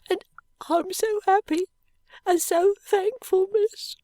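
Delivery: overflowing with grateful tears; heaving heart. A narrator's reading. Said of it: "cried Charlie with a heaving heart".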